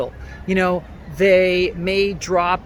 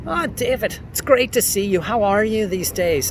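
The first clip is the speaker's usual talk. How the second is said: cheery Irish greeting, higher at the start; Irish accent; 3 semitones higher than usual. Shown as a demonstration. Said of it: "I could do Irish".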